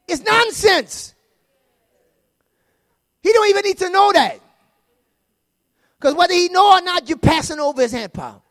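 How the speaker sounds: loud, high, shouted preaching; fiery pulpit preaching, loud and high; full-force preaching. Real, not acted. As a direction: drive it home to the congregation.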